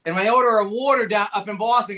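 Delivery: ordinary delivery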